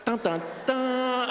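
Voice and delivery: high, slow, loud suspense sting; hammed-up cliffhanger sting, drawn out; dramatic dun dun dun. Shown as a demonstration. Leading the crowd in a dramatic sting. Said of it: "Can I get a dun, dun, dun?".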